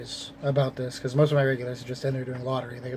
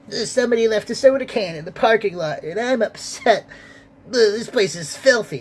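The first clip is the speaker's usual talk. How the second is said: whiny customer mock; 7 semitones higher, 5 dB louder than usual. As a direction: whine it like a fussy entitled customer. Mocking a complaining customer.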